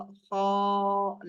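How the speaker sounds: "خا" held long on one level note; elongated letter name. Shown as a demonstration. Teacher reciting Arabic letters, "خا" drawn out long. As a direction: stretch the vowel of "خا" steadily. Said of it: "لمبا کرنا ہے".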